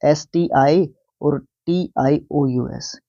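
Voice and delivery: letters read out slowly one by one; teacher spelling out letter by letter. Shown as a demonstration. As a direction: dictate the letters carefully to the class. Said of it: "superstitious".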